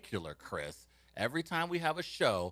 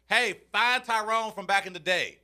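higher, louder mock yell into the phone; 8 semitones higher, 6 dB louder than usual. Stepfather yelling a search request at his cell phone, mock shout. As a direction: bark the order at the phone like an old man.

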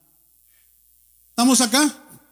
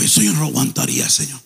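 much lower, fast muttered prayer; 11 semitones lower, much faster than usual. Retold or imitated. Preacher retelling his own prayer to God, low and fast. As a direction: say it to yourself, almost under your breath.

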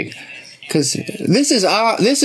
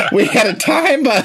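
high, giggly delivery; laughing repeat; 7 semitones higher than usual. Acted. Southern belle tickled by her own line to the caller, high and laughing. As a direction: gush it through laughter.

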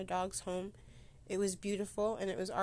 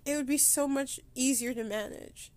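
higher, strained voice near crying; holding back tears; 5 semitones higher than usual. Real, not acted. Podcaster missing her dog, voice near breaking. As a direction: fight the tears while talking about losing everything. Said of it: "I am trying not to cry".

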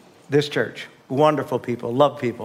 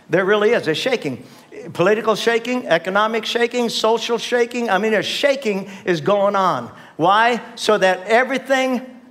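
building sermon climax; 6 semitones higher than usual. Acted. Pastor rallying the congregation about coming shaking, rising preach. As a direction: pitch climbs higher, pushing each shaking harder.

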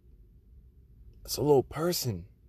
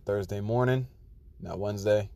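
usual speaking voice

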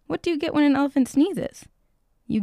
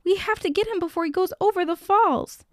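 high, urgent call to rescuers, not a full shout; restrained storybook bellow; 7 semitones higher than usual. A narrator's reading. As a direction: push the pitch up urgently, as in a rescue. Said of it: "bellowed Rodney".

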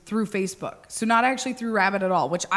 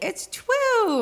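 much higher mom voice on It's true; supermarket mom voice; 14 semitones higher than usual. Retold or imitated. Friend and she imitating a supermarket mom, high. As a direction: chirp it like a middle-aged supermarket mom. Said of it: "she talks like this".

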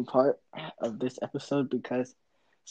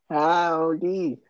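loud, drawn-out Texan greeting, pitched up; Texas accent; 4 semitones higher, 6 dB louder than usual. Shown as a demonstration. Showing off for co-host before the game, exaggerated Texas accent. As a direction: stretch it into an over-the-top Texas twang. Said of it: "do your best Texas accent".

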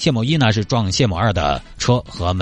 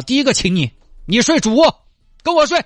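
teasing; 13 semitones higher, 4 dB louder than usual. Acted. Flirting jokingly with granny.

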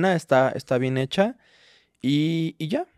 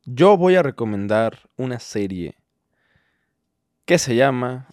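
usual speaking voice

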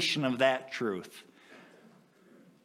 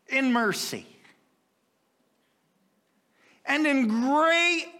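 high, loud, slow, on the edge of tears; impassioned preaching; 11 semitones higher, 4 dB louder, slower than usual. Acted. Preacher overwhelmed by God's love, voice breaking.

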